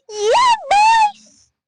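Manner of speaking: very high, loud screaming cheer; shrieked celebration after winning; victory shriek. Real, not acted. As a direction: scream it out in triumph.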